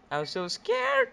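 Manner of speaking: high-pitched scared squeal; loud, squealed nervous fear. Real, not acted. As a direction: let it jump up loud into a frightened squeak.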